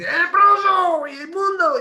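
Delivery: high, slow, stretched-out accusation; accusing shout. Acted. Bystander denouncing the leper in the street, drawn-out accusing shout. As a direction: cry it out to shame him in public. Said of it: "va alguien y le dice".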